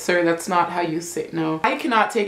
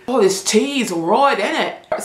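British accent, drawn out, ending on innit; mock British accent; 3 semitones higher, slower than usual. Shown as a demonstration. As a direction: play a Brit praising the tea. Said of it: "The Brits".

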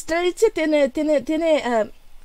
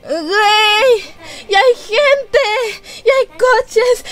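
high, tearful overwhelmed cries; real excited shouting; 5 semitones higher, slower than usual. Real, not acted. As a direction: cry out in awe, stretching the words. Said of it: "Ya sé que estoy gritando". (gritos agudos de emoción, casi llorando)

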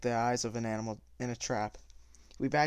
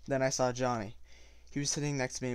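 ordinary delivery, nothing marked

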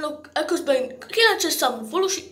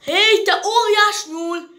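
slow, raised, louder call; 4 semitones higher, 5 dB louder, slower than usual. Acted. Villager hailing a giant rabbit, calling out. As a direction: call out to get a creature's attention.

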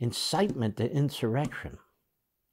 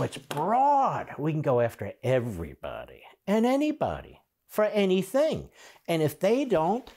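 mocking impersonation; 5 semitones higher than usual. Host parodies congressman claiming a broad mandate, mocking. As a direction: stretch key words in a mocking parody.

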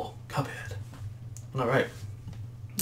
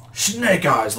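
laughed; 5 semitones higher, 5 dB louder than usual. Acted. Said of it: "laughed the devil".

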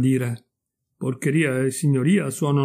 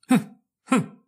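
high little throat-clearing cough; acted cough; 11 semitones higher than usual. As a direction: cough pointedly to get a word in. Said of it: "e incominciò a tossire".

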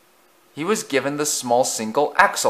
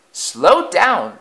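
wailing; 8 semitones higher, 4 dB louder than usual. Acted. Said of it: "they wailed".

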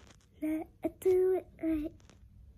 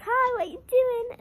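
emo voice; 9 semitones higher, 5 dB louder than usual. Pretend. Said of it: "And I'll be emo".

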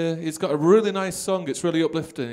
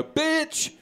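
mock greeting; 12 semitones higher than usual. Friendly greeting said high.

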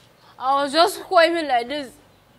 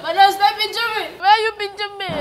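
high, loud indignant complaint; loud protest; 7 semitones higher, 8 dB louder than usual. Acted. Maid complains that madam is pinching her, loud protest. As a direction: cry out as if being pinched.

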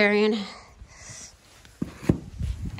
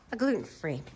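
low, soft repeat of the order; note it quietly to yourself; subdued confirmation; 4 semitones lower, 9 dB quieter than usual. Pretend. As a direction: pitch and volume dropped, settling the matter. Confirming the order after the surprise.

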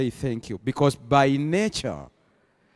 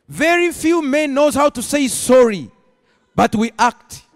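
higher, forceful sermon voice; emphatic preaching; 10 semitones higher, 5 dB louder than usual. Acted. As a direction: press the point on a whole congregation.

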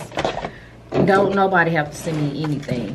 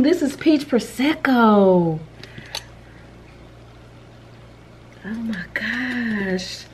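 savoring delight; 2 semitones higher, much slower than usual. Real, not acted. Savoring candle scent.

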